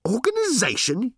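high, incredulous yelled question; outraged yell. A narrator's reading. Reporter seizes on his boss's word, yelling. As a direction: throw the word back at a high pitch. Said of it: "yelled Ford".

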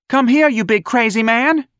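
high, loud call; hollered. Acted. Darlene hollering at Ignatius, high and loud. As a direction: holler across the room. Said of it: "Darlene hollered".

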